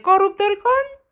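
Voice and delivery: high, laughing break-up; real giggle fit. Real, not acted. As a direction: crack up mid-sentence.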